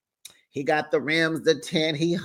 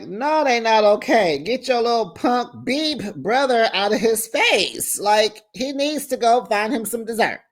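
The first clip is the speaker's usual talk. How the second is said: higher, scolding and dismissive; sassy retort; 6 semitones higher than usual. Retold or imitated. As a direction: tell him off, sassy. Narrator retelling her own outburst.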